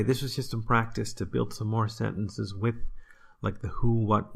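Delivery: ordinary delivery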